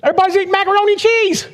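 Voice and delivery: very high whiny complaint; mock whine. Husband whines to wife about missing cheese, very high mock whine. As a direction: whimper it like a deprived kid.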